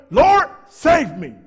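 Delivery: slow, loud desperate plea; desperate cry. Retold or imitated. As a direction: cry out like a drowning man. Peter sinking, crying out.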